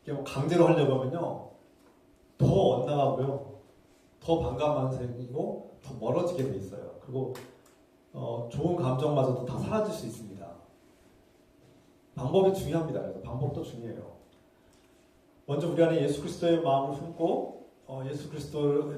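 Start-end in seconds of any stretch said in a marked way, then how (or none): none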